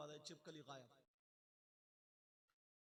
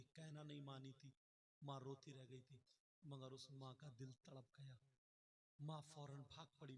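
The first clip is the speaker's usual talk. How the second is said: ordinary talk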